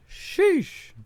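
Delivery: high, exasperated sheesh. Real, not acted. Exasperated exclamation.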